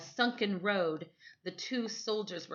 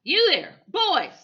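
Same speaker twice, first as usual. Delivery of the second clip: loud, high shout across the field; calling out; 11 semitones higher, 12 dB louder, slower than usual. A narrator's reading. Photographer calling the boys, loud high shout. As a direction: call the boys over.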